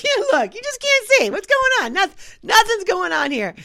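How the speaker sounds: father shielding his daughter, high flustered voice; flustered dad. Retold or imitated. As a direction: squeak it out like a dad covering his kid's eyes.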